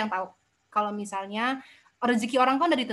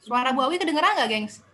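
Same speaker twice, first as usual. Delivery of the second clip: loud, raised voice checking the audio; calling out loudly; 3 semitones higher, 5 dB louder than usual. Real, not acted. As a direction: call out so everyone can hear. Checking if audience can hear.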